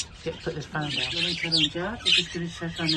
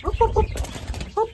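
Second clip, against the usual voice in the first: startled oops; 14 semitones higher, slower than usual. Real, not acted. Bird hopping about, startled.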